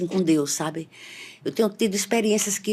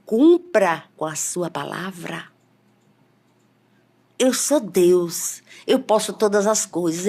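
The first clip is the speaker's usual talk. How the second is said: voice of God. Retold or imitated. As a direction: lay down God's words heavily and deliberately. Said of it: "a missionária começou a repetir".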